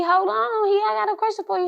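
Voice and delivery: high, loud imitation of Spook cutting in; urgent hold-on. Spook stopping the mother to ask his question, high urgent voice. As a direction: cut her off high and urgent.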